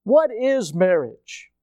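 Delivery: ordinary talk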